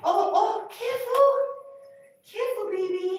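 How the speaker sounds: high alarmed call. Real, not acted. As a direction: warn sharply, pitch leaping up. Cat about to fall.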